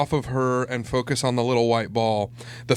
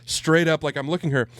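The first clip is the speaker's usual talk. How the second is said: normal talking voice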